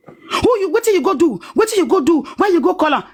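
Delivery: high pitched retold scolding; imitating her furious challenge to him; mock angry outburst. Retold angry confrontation with man.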